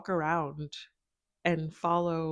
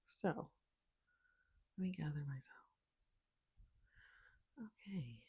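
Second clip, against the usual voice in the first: slow whisper to calm down; whisper to herself; 12 dB quieter, slower than usual. Real, not acted. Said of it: "like, slow down".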